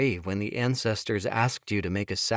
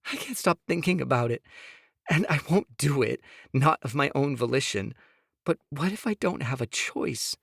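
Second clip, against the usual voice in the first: higher, pleading woman's voice; Sophia pleading; 6 semitones higher than usual. Acted. Wife begging husband to hear her, higher pleading. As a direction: plead with him, voice pitched up.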